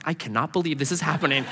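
muted disbelief. Retold or imitated. Companion watching a waitress accept prayer, muted disbelief. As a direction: say it quickly under your breath.

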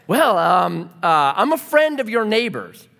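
stall, then blurt it awkwardly; nervous stammer. Retold or imitated. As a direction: act out flustered self-introduction. Nervous visitor explaining himself.

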